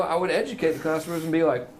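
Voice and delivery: usual speaking voice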